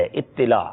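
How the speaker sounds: اطلاع with doubled t and short i: it-ti-laa; correct pronunciation. Shown as a demonstration. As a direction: model اطلاع in three syllables, it-ti-laa. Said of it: "تو پہ تشدید ہے".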